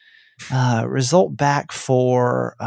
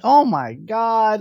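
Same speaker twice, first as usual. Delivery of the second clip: drawn-out, higher mock dismay; retold freak-out; 6 semitones higher, much slower than usual. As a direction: stretch it out in replayed panic. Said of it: "I'm just freaking out".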